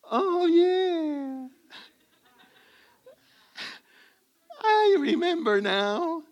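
high, hammed-up playing-dumb voice; mock a husband pretending he just remembered. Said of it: "the guy wants to act a little stupid".

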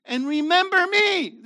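high and loud quoting Yeshua; preacher quoting Jesus, high and loud; loud quote. Acted. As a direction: ring it out from the pulpit. Quoting Yeshua mid-sermon.

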